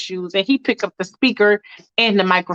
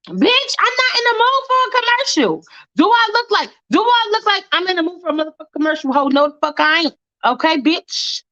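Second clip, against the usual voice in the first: much higher exasperated outburst, fast at the end; real annoyed rant; 8 semitones higher than usual. Real, not acted. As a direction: vent at the ad in real irritation.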